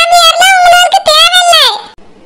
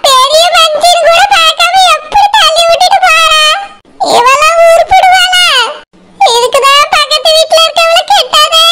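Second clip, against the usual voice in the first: wailing lament. Acted. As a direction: wail over the ruined family, crying out. Fallen mother-in-law wails and curses.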